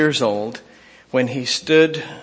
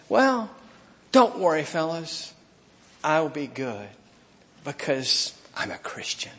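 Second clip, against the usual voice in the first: softer and softer, fading into a mock whisper; a smug holier-than-thou aside, trailing off; mock pious Joseph; 7 dB quieter than usual. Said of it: "he didn't milk this either and say".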